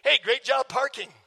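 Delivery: mock-praising jab; sarcastic. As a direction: retell a sarcastic compliment to your wife. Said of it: "but I'm kinda sarcastic".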